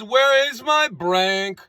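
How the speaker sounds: drawn-out slow question; quoting the singer slowly; slow quoted line. Retold or imitated. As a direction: stretch out Where is my Brank. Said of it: "he says".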